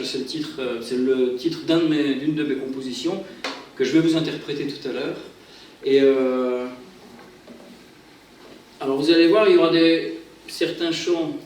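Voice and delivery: ordinary delivery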